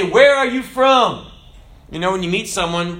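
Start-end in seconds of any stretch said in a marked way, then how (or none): 0.0-1.3 loud, high, slow demand; forceful challenge. Shown as a demonstration. Preacher demonstrating questioning a thought, forceful challenge. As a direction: challenge it slowly and loudly.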